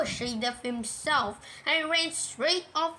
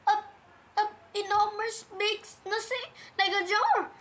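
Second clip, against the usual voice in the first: high squeaky little creature groping for words; high-pitched, halting alarm; flustered, frightened Piglet; 7 semitones higher than usual. Acted. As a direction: stammer excitedly in a high small voice.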